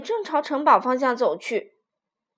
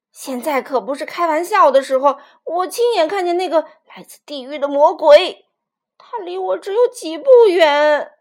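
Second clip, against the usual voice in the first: agitated outburst; 4 semitones higher, 12 dB louder than usual. Acted. Innkeeper insists on the monster.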